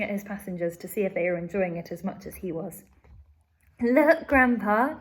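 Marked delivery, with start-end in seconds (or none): 3.8-5.0 loud, slow eager call; excited cry. Acted. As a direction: call out to grandpa, thrilled. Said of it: "cried Charlie".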